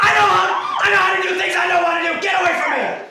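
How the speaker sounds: loud, rising angry yelling; furious shout. Acted. Son yells at the family to keep away, furious. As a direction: drive them back with raw fury.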